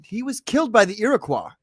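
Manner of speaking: ordinary talk, nothing marked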